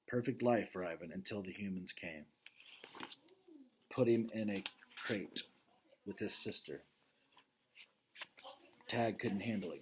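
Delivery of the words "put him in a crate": plain reading voice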